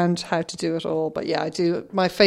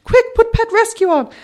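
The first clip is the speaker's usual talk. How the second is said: high, loud, urgent mock mum; a mum hurrying her grown daughter to the telly; mum's urgent call; 8 dB louder than usual. Shrill urgent mother. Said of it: "and said".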